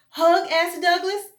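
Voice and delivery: high, loud, slow building call to mommy; joyful rush. A narrator's reading. Douglas running to his mommy, building to a loud high cry. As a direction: swell with joy as he runs.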